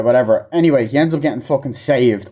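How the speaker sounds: ordinary talk, nothing marked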